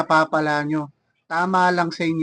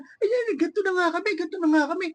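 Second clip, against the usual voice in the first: much higher, sing-song mock of boastful helpers; mocking whine; 11 semitones higher, faster than usual. Vlogger mocks show-off helpers with a much higher, whiny mock voice. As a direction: mimic them rubbing their help in your face.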